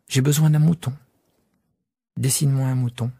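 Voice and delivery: put-on a small boy insisting on his sheep; young prince pressing his request; little prince. Child prince asks for sheep.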